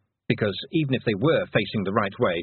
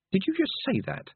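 high, hushed disbelief; inner critic. A narrator's reading. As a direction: question yourself in a small high voice. Second thoughts voiced as inner critic.

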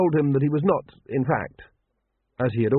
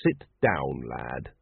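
gentle, heavy; 11 semitones lower, 5 dB quieter, much slower than usual. A narrator's reading. Father about to break hard news.

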